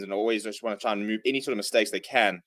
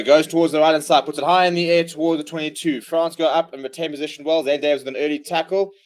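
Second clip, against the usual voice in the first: live play-by-play; 4 semitones higher, 6 dB louder than usual. Acted. Live rugby commentary.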